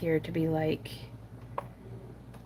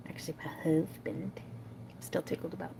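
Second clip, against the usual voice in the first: whispering; 2 semitones lower, 7 dB quieter than usual. Real, not acted. Shy correction aside about partner.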